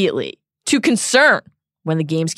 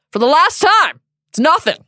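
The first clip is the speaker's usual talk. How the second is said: loud, high exasperated Hagrid; Hagrid's voice; 9 semitones higher, 5 dB louder than usual. Acted. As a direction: brush it off, fed up. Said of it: "Hagrid replies".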